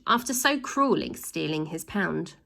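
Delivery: usual speaking voice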